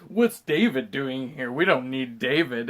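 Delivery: slow, low, loaded disdain; grumbling slowly about an unwanted guest; dismissive mock grumble. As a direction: drag it out like someone unimpressed. Acting out film character objecting.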